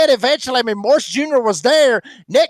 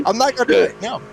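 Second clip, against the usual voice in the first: ordinary talk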